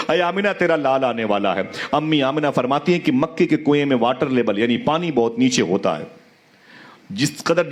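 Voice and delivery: storytelling preacher, voice rising and falling with emotion; impassioned sermon. Acted. Preacher to the gathered congregation, impassioned storytelling. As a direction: tell it to move the congregation to tears.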